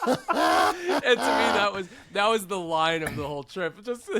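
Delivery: talking through a high laugh. Real, not acted. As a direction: laugh out the words. Laughing while retelling.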